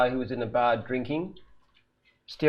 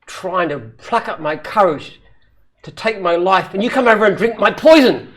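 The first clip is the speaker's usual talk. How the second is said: rising, louder, breaking into laughter; punchline laughing; 5 semitones higher, 7 dB louder than usual. Acted. Joke teller laughing through his own punchline. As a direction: build up and crack up on the last word. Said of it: "He said".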